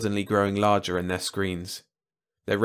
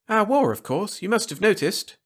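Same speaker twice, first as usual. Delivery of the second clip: high-pitched, expectant alien voice; eager Malan host; 11 semitones higher than usual. A narrator's reading. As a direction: ask keenly, voice pitched way up.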